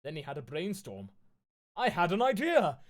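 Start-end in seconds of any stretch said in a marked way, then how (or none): 1.8-2.8 higher, louder burst of excitement; exclaiming. Acted. Ebony announcing her idea to Vampire, mock excited exclaim. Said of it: "I exclaimed".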